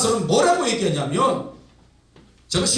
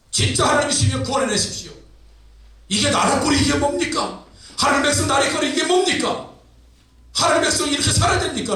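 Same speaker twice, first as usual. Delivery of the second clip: acted high-pitched, demanding complaint; indignant protest; 4 semitones higher than usual. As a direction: challenge God in exasperation. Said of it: "막 항변을 합니다". (높은 음조로 따지는 항변 연기)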